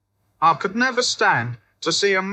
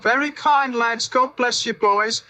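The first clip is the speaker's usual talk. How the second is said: put-on higher, doddering old babushka voice; old women's blessing; 6 semitones higher than usual. A narrator's reading. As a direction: coo it like grateful old grannies. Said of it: "They started on the old".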